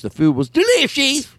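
put-on British accent leaping high on the last word; over-the-top British accent. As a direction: squeal it in mock British. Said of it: "doing a British accent".

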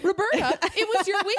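high, hammed-up reproach; scolding soccer mom. Soccer mom to parent who missed snack day, high mock scolding. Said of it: "soccer mom who somebody missed snack day".

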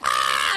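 shouted mock crowd cheer; wordless roar of a hyped audience. Comic as the excited club crowd, mock roar. Mimicking crowd roaring back.

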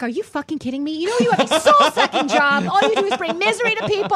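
loud, high-pitched furious rant; retold outburst, building to a yell; losing it. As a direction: blow up at someone, acting out the tirade. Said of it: "I lost it".

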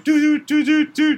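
high piping flute toots; imitated toy flute. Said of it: "played an instrument where I was like".

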